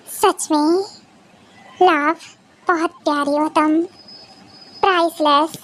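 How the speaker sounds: low, hushed and unhurried endearments; tender devotion. Acted. Husband telling his wife she is his peace, tenderly. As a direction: speak intimately, with long pauses to let it sink in.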